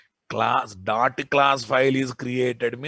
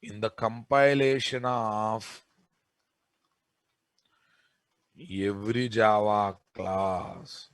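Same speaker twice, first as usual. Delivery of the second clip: slow, soft dictation for note-taking; 6 semitones lower, 5 dB quieter, slower than usual. Acted. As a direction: read it out word by word, slowly. Dictating notes slowly.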